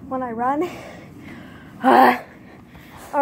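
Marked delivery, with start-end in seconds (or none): 0.7-1.8 long breathy out-of-breath sigh; winded sigh; heavy exhale. Real, not acted. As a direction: blow out air after running. Winded between sprint runs.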